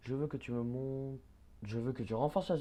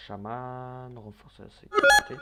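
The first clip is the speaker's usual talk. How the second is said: exasperated shout; 12 dB louder, slower than usual. Real, not acted. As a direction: lose patience and yell it. Yelling order at shaman unit.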